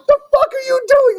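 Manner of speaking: high-pitched mock disbelief; comic high-voiced shock; retold incredulity. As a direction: squeal it in baffled outrage, imitating yourself. Retold shock at hand in cup.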